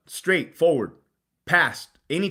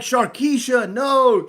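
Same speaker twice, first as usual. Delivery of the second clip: mock crowd yell; 7 semitones higher, 5 dB louder, much slower than usual. Onlookers yelling in video.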